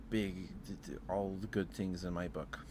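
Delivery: normal talking voice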